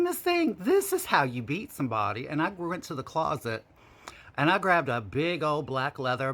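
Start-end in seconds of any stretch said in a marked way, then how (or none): 0.0-2.3 high, loud sassy Miss Thing; loud, sassy schooling. Retold or imitated. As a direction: show her who's boss, diva style. Showing sister how it's done.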